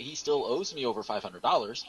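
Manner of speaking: usual speaking voice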